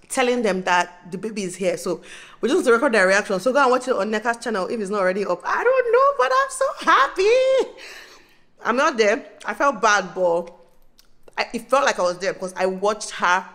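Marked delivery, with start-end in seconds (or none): 5.4-7.7 high, loud, stretched-out happy cry; can't hold it in; joyful squeal. Real, not acted. To her viewers about the new baby, an overjoyed high squeal. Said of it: "I'm screaming".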